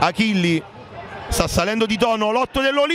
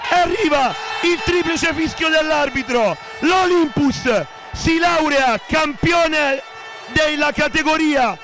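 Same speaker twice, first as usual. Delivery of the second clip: excited final call; 4 semitones higher than usual. Real, not acted. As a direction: proclaim the title win. Final whistle, title won.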